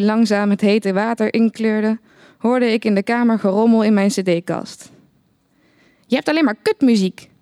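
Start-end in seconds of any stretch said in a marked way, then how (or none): 6.1-7.3 lightly voiced cry of complaint; mild called-out complaint. A narrator's reading. Geert Wilders complains about the narrator's music, mildly calling out. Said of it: "riep Geert Wilders".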